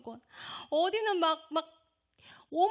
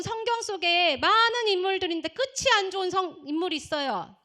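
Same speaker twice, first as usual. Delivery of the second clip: loud, raised exhortation; forceful preaching; 5 semitones higher, 6 dB louder than usual. Real, not acted. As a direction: drive it home to the congregation.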